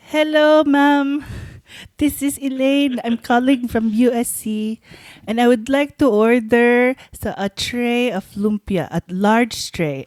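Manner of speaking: put-on high, slow Filipino-accented phone manner. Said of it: "So I called and I was like".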